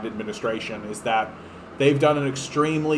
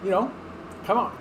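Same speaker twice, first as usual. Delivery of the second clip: put-on higher, old-man Biden voice; Biden impression; 5 semitones higher, 4 dB quieter than usual. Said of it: "but just old, so that you're like".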